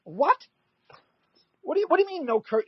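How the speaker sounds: high, fast, incredulous retelling; shocked. Retold or imitated. Comic to TV producer about cursing ban, fast shocked disbelief. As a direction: sputter in disbelief, rushing.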